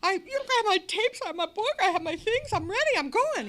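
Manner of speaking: high, loud, fast excitement; over-eager newcomer bursting to go; eager rush. Retold or imitated. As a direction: insist breathlessly you're ready. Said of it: "I said".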